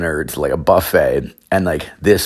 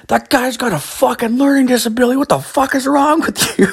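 outraged yell. Retold or imitated. Parking attendant yelling at the dad who punched his helper, high outraged yell. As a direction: screech it like you can't believe it.

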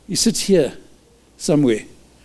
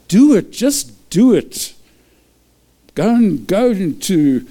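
emphatic urging; 5 semitones higher, 3 dB louder, slower than usual. Acted. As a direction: Do it punched loud, then Just do it slowed right down. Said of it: "urging the congregation".

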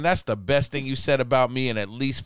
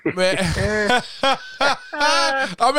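laughing; 7 semitones higher than usual. Real, not acted. Lips burning from spicy drink.

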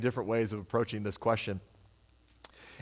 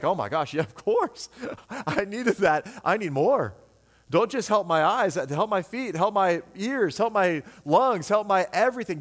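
higher and louder, pleading eagerly for more; eager needy believer; 6 semitones higher, 3 dB louder than usual. Retold or imitated. Preacher voicing a humble person begging Jesus, eager and loud. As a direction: beg like a thrilled child asking for everything. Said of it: "You just be like".

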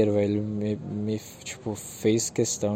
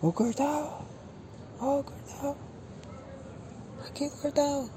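much higher teasing sing-song call; mock calling; 6 dB quieter than usual. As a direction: call out to him in a high mocking voice. Teasing the man with headphones.

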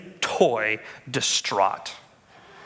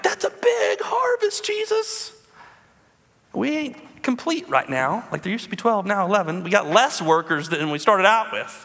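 mock-panicked disciples; 6 semitones higher than usual. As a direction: play the nervous disciples. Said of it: "they're like".

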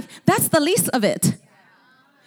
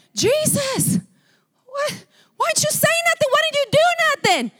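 high-pitched, incredulous protest; exasperated complainer; 11 semitones higher than usual. Retold or imitated. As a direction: imitate a baffled man demanding answers. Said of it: "he got frustrated and angry".

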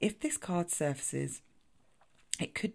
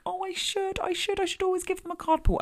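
high-pitched, loud mock self-reproach; voiced inner dithering; 5 dB louder than usual. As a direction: fret aloud in a high, put-on voice. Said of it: "I kind of think".

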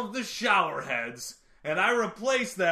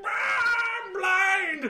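pained yell; 11 semitones higher, much slower than usual. Retold or imitated. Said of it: "they'll just be like".

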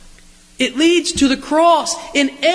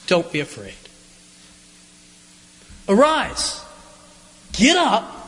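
tender reassurance; 2 semitones lower than usual. Acted. Jesus comforting the frightened disciples.

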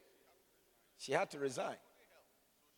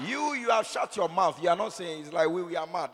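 loud, higher mock scolding; teasing shout; 5 semitones higher, 12 dB louder than usual. Pastor teasing a silent congregation, loud mock scolding. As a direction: shout at them playfully.

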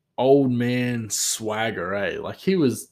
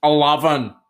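loud, higher Scottish "Eleven"; attempted Scottish accent; 4 semitones higher, 6 dB louder than usual. Shown as a demonstration. Vlogger demonstrating his best word, attempted Scottish accent. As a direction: show off your one Scottish word, "Eleven". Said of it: "about as Scottish as I can do".

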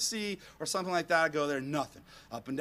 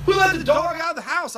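high, loud exasperated shout; retold fed-up outburst; frustrated; 10 semitones higher, 8 dB louder than usual. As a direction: yell it in acted-out disbelief. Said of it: "I'm all frustrated".

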